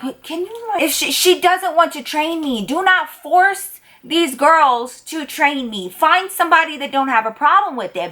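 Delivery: high, pleading inner complaint; exasperated plea. Retold or imitated. The new girl silently pleading with her boss, exasperated. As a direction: beg him to stop forcing it.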